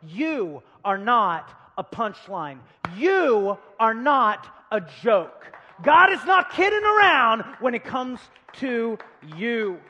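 loud, slow, hammered preacher's climax; emphatic preaching. Real, not acted. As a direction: drive it home to the congregation at full force.

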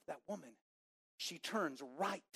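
normal talking voice

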